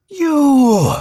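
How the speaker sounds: very high, loud held wail; ghostly wail. Acted. Ghost cries out a third time.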